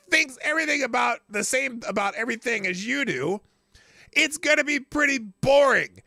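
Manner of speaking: loud, high-pitched ranting; worked-up rant. Real, not acted. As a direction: get fired up and rant. Ranting about echo chambers.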